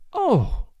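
lightly voiced lament; plaintive. Worried woman lamenting the dog, plaintive. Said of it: "she went on plaintively".

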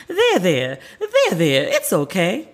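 mock soothing princess voice; imitated consoling. Princess comforting a sobbing grown fan, mock consoling voice. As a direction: console with exaggerated, swooping there-theres.

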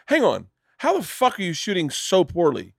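high-pitched retold disbelief; incredulous. Club fitter to a golfer who hits well, incredulous. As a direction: demand an explanation, pitch climbing.

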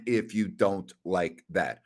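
a host signing off his segment with his trademark line, low and slow; signature catchphrase. Acted. Deep, drawn-out sign-off. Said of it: "they heard my catchphrase".

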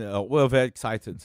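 a light imitation of a German accent; a hint of a German accent. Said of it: "he had a very heavy accent".

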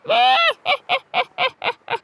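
high crowing rooster laugh; cock-a-doodle laugh. A narrator's reading. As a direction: cackle like a rooster at his own joke. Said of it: "falling about laughing".

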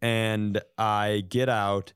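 ordinary talk, nothing marked

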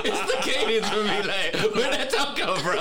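high, laughing through the words; can't stop cracking up; high laugh over the pickup line; laughing hard. Real, not acted.

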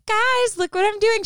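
high, eager imitation of a roommate; excited call. [name] imitating Alicia showing off her cleaning, high and eager. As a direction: chirp it up like she's proud of cleaning. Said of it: "She was excited to clean".

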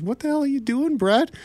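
high, startled woken-up guy; startled groggy friend. Retold or imitated. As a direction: squeak it out like you were just jolted awake.

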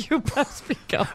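laughing farmer line. Retold or imitated.

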